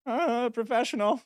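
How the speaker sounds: high, put-on voice; mock professional flourish. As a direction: pitch it way up in self-mockery. Jokes about calling himself professional.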